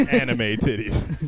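laughing. Real, not acted. Laughing repeat.

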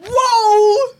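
loud, high, drawn-out whoa; indignant whoa. Retold or imitated. Retelling her reaction to rude fans, loud mock outrage. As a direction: hold one outraged note, high and loud. Retold exclamation to rude strangers.

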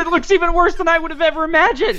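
high, rising horror at a photo; shocked and disgusted; horrified surprise. Real, not acted. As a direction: recoil as you see it. Reacting to the gross photo.

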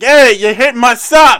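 drawn-out, high mock yell; angry driver's shout. Co-host as captain yelling at the other sub, mock outrage. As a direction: holler it slowly like a wronged driver. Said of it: "come out and be like".

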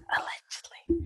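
barely voiced whisper; whispered aside. Real, not acted. Hedging a claim about their ages, whispered aside. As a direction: drop to a very soft whisper.

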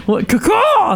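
high squawking caw-caw; chicken noise. Acted. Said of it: "That's our chicken noise".

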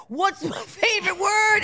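high, shouted imitation of a song's call; playful call-out. Parent or friend quizzing Cammy with a song line, playful shout.

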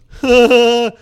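loud, high exaggerated gasp; hammed-up shocked gasp; mock gasp. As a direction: gasp like a scandalised onlooker. Acting out onlooker's loud reaction.

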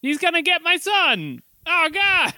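high, frantic anime hero; panicked anime voice. Retold or imitated. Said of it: "Lots of like".

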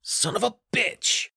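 high, drawn-out angry curse; yelled curse. A narrator's reading. Guard sees his man kicked, yelled curse. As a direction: yell it high, held back in volume. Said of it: "Loder yelled".

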